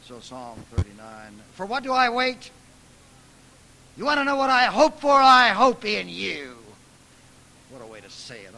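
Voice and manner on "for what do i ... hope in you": defiant hope flung at God; indignant cry. Acted. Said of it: "voice the indignation".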